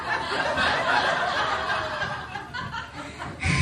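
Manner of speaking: breathless, wheezy laugh without voice; real laugh. Real, not acted. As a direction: let a silent laugh shake out of you. Laughing at her own overshare.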